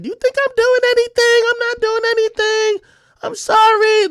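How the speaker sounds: high, whiny imitation of crying; mock crying. Girlfriend protesting innocence on the phone, mock tearful wail. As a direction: wail it in a mocking high pitch. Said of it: "chicks just start crying for no reason".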